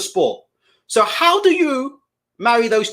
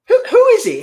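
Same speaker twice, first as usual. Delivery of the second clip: incredulous outburst; 12 semitones higher, 6 dB louder than usual. Real, not acted. Ranting at Eddie Hearn.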